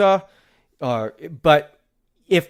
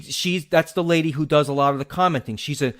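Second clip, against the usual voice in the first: normal talking voice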